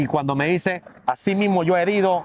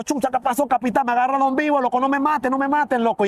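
higher, urgent begging, imitated; retold plea to the captain, higher and desperate; pleading for life; 7 semitones higher than usual. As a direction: beg the captain not to let them kill you.

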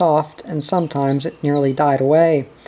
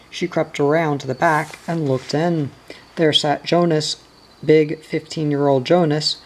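plain reading voice

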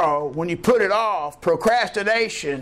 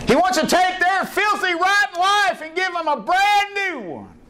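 preaching shout; 13 semitones higher than usual. Real, not acted. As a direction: lift it up and shout it to the church. Preacher shouting at full force.

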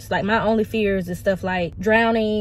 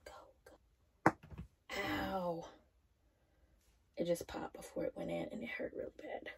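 real whisper under her breath; 21 dB quieter than usual. Real, not acted. Alone trying a tampon, whispering to herself. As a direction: breathe the words out, no voice.

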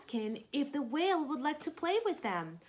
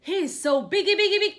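higher and much louder squeal; excited Piggy; 8 semitones higher, 12 dB louder than usual. Acted. Reading to kids, Piggy marvelling at the huge whale. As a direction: squeal with delight at how big he is. Piggy voiced in picture book.

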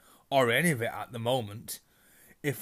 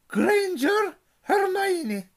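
high, loud stern roll call; calling a name; 7 dB louder, much slower than usual. A narrator's reading. McGonagall calls the next student to the stool. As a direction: call the name out across the hall.

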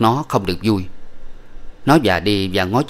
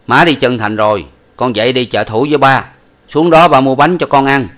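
put-on louder father coaxing the boy along; 4 semitones higher, 7 dB louder than usual. A narrator's reading. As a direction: coax the child, loud and firm. Father answers his son.

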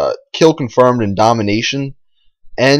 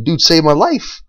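ordinary talk

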